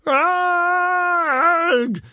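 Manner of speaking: long, high, loud scream; terrified screech. A narrator's reading. Gobber screeching at the corpse in his face, terrified screech. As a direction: screech in shock at full pitch. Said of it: "screeched Gobber the Belch".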